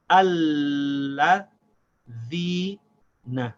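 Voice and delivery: ALLAH, THE, NA with pauses between the parts; syllable by syllable. Shown as a demonstration. Teacher building the word in parts, syllable by syllable. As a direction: hold the l and the ee, separate each piece.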